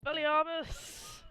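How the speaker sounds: high, loud, drawn-out spell cry; mock spell incantation. Players tossing out joke names for the magic shop, mock incantation. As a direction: call out a wizard's spell, stretched out.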